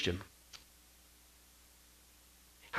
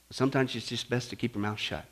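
ordinary talk

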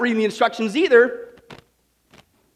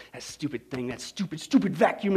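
low, much softer exasperated grumble; fed-up husband grumbling, lower and soft; 4 semitones lower, 4 dB quieter than usual. Retold or imitated. Husband blaming the vacuum to his wife, exasperated and low. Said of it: "he gets all exasperated".

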